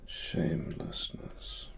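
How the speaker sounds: normal talking voice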